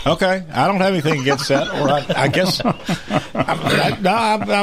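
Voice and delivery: chuckling through the words; laughing while answering. Real, not acted. Laughing at orange jumpsuit joke.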